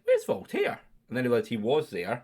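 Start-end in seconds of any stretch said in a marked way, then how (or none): 0.0-0.8 high-pitched, baffled question; mock-baffled squeak of a question; retold puzzlement. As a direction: re-enact being puzzled, voice jumping right up. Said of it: "and then I was like".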